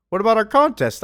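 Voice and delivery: high, loud complaint; whiny protest. Acted. Atlas and Samson to Superman about the contest, indignant protest. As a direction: object like cheated contestants.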